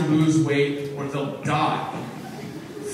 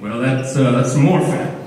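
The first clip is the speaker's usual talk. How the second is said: Wilford Brimley; 5 semitones lower, 3 dB louder than usual. Pretend. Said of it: "I'll sound like Wilford Brimley".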